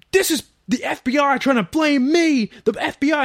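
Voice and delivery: high, loud yelling accusation; explode, shouting at the FBI; furious outburst. Retold or imitated. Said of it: "he goes fucking off".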